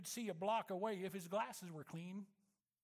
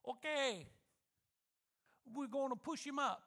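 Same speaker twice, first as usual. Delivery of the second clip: put-on light Italian-accented father, louder and higher; 7 semitones higher, 5 dB louder than usual. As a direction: announce it like an Italian papa, firm. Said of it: "announced in his Italian accent".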